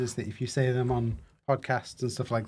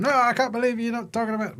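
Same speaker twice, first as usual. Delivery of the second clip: mock Susie; 9 semitones higher than usual. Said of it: "Susie's sitting in the comments going".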